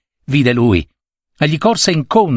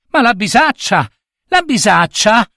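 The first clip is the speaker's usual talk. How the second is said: high, urgent questioning; alarmed cry; 9 semitones higher than usual. A narrator's reading. Mistress asks after the saddlebag, alarmed cry. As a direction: cry out high, without much extra volume. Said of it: "gridò la padrona".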